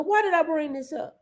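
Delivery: ordinary talk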